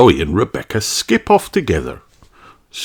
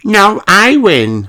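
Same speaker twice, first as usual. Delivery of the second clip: high, slow insistent little sheep voice; contradicting; 12 semitones higher, 4 dB louder, slower than usual. A narrator's reading. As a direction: argue back firmly. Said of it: "says Susie".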